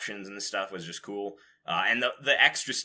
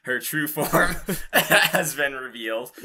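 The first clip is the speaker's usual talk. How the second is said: laughing through it; 4 semitones higher, 5 dB louder, slower than usual. Real, not acted. Laughing mid-sentence about manga.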